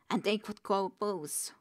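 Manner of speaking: plain reading voice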